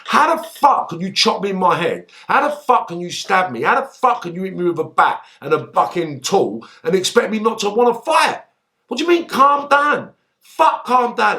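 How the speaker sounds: high, heated tirade; angry rant. Retold or imitated. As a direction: rant at the men who attacked him.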